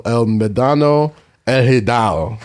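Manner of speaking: Spanish place names in a Spanish accent. Real, not acted. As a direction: say it like a Spanish speaker. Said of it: "That's your Spanish accent".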